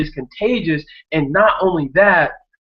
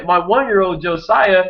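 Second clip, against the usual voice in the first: usual speaking voice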